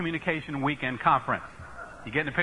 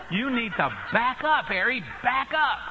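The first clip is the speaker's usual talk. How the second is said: slow, higher-pitched emphatic order; mock urgent warning; 6 semitones higher, slower than usual. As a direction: stretch it out as a comic warning. Warns himself about elderly driver.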